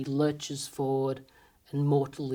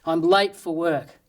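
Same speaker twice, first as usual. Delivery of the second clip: louder, slow, mock-indignant yelling; mock shout at a red light; 3 semitones higher, 7 dB louder, slower than usual. Late driver yells at the red light, mock shout. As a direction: scold the traffic light like it wronged you. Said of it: "You shout at the red light".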